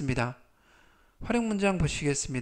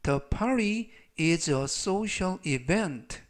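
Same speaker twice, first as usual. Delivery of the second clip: very slow, evenly spaced words; slow model sentence; much slower than usual. Shown as a demonstration. Teacher slowly reads the party sentence to the class. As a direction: read it slowly for students to follow. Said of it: "전체적 의미는".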